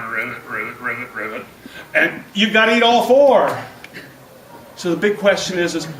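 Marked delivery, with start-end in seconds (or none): 0.0-1.4 frog croak. Retold or imitated. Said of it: "it's looking up at you going".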